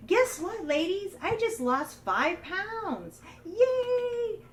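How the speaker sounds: high, loud, slow sing-song bragging; mock gloating cheer. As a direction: play the tactless friend showing off. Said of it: "And I walk in and I say".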